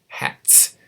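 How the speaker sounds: "Hats" whispered, with a hard ts; "Hats" unvoiced, stressing the final ts; whispered demo. Shown as a demonstration. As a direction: push air through the teeth on "Hats". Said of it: "like the end of the word hats".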